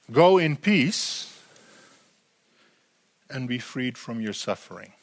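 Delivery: slow blessing. Shown as a demonstration. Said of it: "There's two aspects to this".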